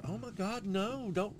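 high-pitched horrified protest; high, dismayed pleading; mock alarm. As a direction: imitate an appalled cousin stepping in. Cousin's alarmed protest retold.

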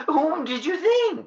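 very high put-on voice; high falsetto, affecting to be someone else; posing as Alastair.

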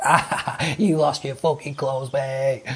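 lightly voiced laughing mock of a mate. Mates laughing at friend who lost clothes, mocking. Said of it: "Everyone was like".

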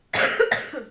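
sharp loud cough; one harsh cough into the mic. Real, not acted. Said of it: "I have a cough".

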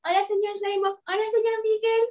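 mimicking the newcomer's polite hello; mock polite greeting. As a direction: imitate a shy polite girl greeting. Mimicking her formal greeting.

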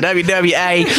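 put-on loud drawled Southern accent, laughing. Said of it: "somebody with a thick enough Southern accent".

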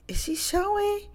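high-pitched "Is she showing?" rising in curiosity; high curious question. Retold or imitated. Gossiping friend at lunch table asks, high and nosy.

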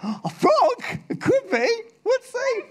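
high, playful pretend surprise to a child; mock surprise. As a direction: humor the kid, pitched high. Teller playing along with child.

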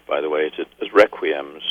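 usual speaking voice

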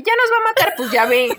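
laughing. Real, not acted. Laughing at her own panic.

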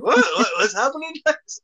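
flustered. Retold or imitated. Retelling the organized podcast, mock-panicked high questions. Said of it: "I'm like".

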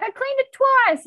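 very high, slow, loud protest; voicing Jody, squeaky and defensive; slow, high hammed-up protest. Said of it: "Jody's like".

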